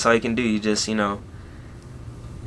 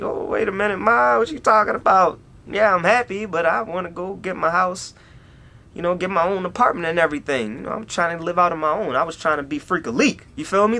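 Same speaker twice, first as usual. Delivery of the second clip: lightly voiced eager son, higher; playful pleading, pitched up; excited retold self; 6 semitones higher than usual. Son telling mom he wants his own apartment, eager and higher. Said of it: "And I'm like".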